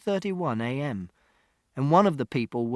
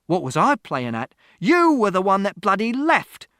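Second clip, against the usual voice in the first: loud retort climbing in pitch; accusing shout; 8 semitones higher, 8 dB louder than usual. A narrator's reading. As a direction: build to a furious accusation. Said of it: "Father shouted".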